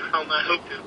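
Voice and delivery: ordinary delivery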